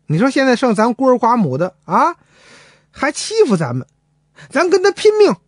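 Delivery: rising to a high cry on the last words; indignant old mother. Acted. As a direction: build from grievance to a fighting cry. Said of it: "龙婆很气愤".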